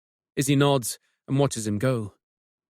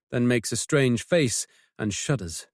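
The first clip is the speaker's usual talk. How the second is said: usual speaking voice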